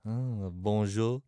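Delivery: slow breathy mock French woman; drawn-out French greeting; French accent. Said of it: "Okay, you're a French woman".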